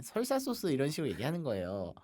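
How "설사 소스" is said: 설사 said high, vowel like "seol"; mispronounced 설사. Retold or imitated. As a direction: imitate saying 설사 instead of 살사. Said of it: "따라해보라고 했더니".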